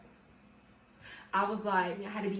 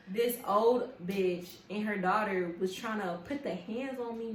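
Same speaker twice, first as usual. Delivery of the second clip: ordinary talk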